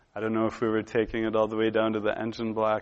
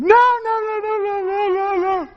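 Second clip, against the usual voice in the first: very high-pitched mock wail; loud squealed string of frantic no's; tearful panicked refusal; 8 dB louder than usual. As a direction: act out a child's freaked-out, crying protest. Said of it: "I was in tears".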